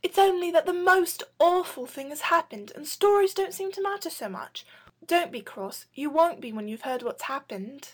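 Robert calming the Phoenix softly; kindly. A narrator's reading. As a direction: gently soothe the ruffled bird. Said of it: "adding kindly".

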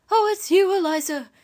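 high voice of a startled invalid; trembling sick woman. A narrator's reading. Sick woman recognising Eliza, high startled voice. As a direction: exclaim high up as the woman in bed. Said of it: "sitting up in bed trembling".

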